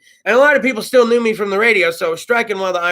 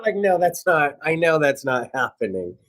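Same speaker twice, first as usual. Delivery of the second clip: ordinary talk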